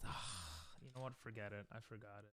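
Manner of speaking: ordinary talk, nothing marked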